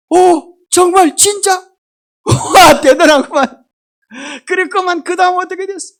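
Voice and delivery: loud, high, drawn-out wow of interest; eager responses. Shown as a demonstration. Preacher acting a husband's loud, eager replies to his wife. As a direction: cheer her on with big exaggerated reactions. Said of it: "맞장구를 치더란 거예요".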